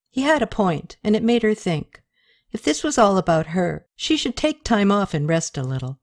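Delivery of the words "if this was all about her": plain reading voice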